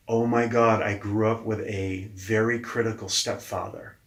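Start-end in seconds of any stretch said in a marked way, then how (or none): none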